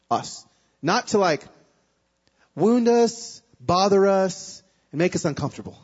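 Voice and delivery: normal talking voice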